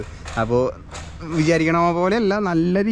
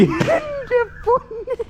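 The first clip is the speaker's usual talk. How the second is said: very high, long drawn-out gasp of awe; awestruck; much slower than usual. Real, not acted. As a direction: stretch it out, stunned. Watching big drop.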